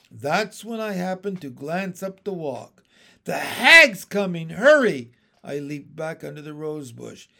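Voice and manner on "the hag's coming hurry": loud, alarmed call; pressing, punched exclamations; urgent warning. A narrator's reading. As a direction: warn him to get moving. Urgent warning to other frog.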